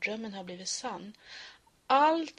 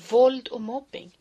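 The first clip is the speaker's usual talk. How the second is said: louder, emphatic title; 10 dB louder than usual. Acted. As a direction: proclaim it forcefully. Title repeated loud.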